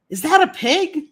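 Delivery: high, loud yell from a doorway; yelled question. Retold or imitated. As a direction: shout it out the bar door in disbelief. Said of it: "I hear this dude yell out the door".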